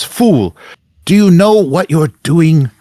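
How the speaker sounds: high, loud outraged villain cry; Zacharias. Acted. Game master as Zacharias rebukes the interrupter, outraged and loud. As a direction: project it high and indignant, stretching the words. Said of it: "so Zacharias ... and says".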